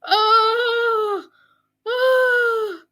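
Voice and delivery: high loud shocked oh; loud, high gasping oh; shocked cry. Real, not acted. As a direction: cry out in disbelief. Reacting in shock to video.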